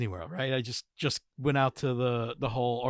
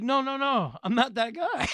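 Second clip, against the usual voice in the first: higher-pitched, unangry correction; imitated protest, not mad; 8 semitones higher than usual. Quoting the stranger setting him straight, not mad. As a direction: wave off the mistake without anger, pitched up. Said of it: "he didn't come over mad".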